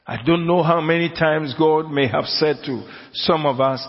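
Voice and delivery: usual speaking voice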